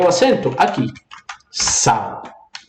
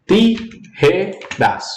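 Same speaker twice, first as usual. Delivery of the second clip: "Tijeras" slowly split into separate syllables; broken into syllables; 5 semitones higher, much slower than usual. Shown as a demonstration. Teacher breaks the word for students, syllable by syllable. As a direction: say "Tijeras" syllable by syllable, pausing between each. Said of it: "quebro a palavra".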